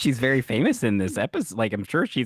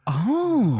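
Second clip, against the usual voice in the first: surprised; 13 semitones higher, 4 dB louder than usual. Real, not acted. Surprised reaction.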